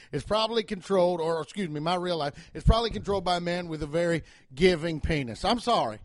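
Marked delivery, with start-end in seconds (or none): none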